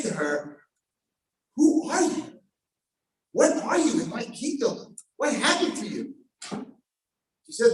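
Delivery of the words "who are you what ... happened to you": higher, amazed asking; astonished questions. Acted. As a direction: ask in wonder. King questions the girl.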